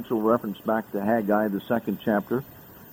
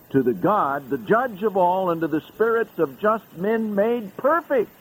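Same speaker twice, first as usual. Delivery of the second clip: soaring scripture proclamation; 9 semitones higher than usual. Acted. Preacher proclaiming Mount Zion to the congregation, soaring and high. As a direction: lift every phrase up toward heaven. Reads heavenly Zion passage.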